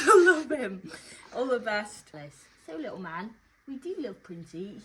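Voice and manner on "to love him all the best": reading through a burst of laughter; loud, higher, breaking into a laugh. Real, not acted. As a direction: let the laugh take over mid-sentence. Laughs while reading the message.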